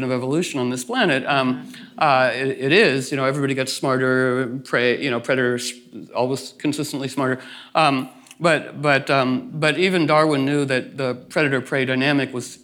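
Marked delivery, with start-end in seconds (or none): none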